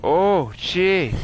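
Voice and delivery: high, loud startled cry; panicked yelp. Real, not acted. Monster swiping at him.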